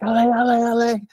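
high-pitched French cheer Allez, allez; kids cheering Allez. Retold or imitated. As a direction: sing out Allez like shrill kids at the roadside. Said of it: "screaming at every rider in whatever language".

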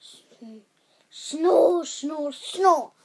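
big rumbling snores; loud giant snoring. Acted. Narrator voicing the giant's snores. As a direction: snore like a huge sleeping giant.

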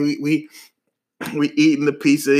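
ordinary talk

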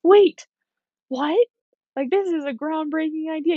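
high, loud startled cry that settles into high disbelief; the body slamming on the brakes in panic; sudden high alarm from the body, then shocked protest. Pretend. Your body's going.

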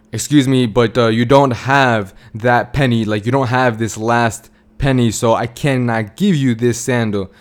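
plain reading voice

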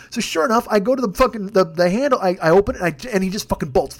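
ordinary talk

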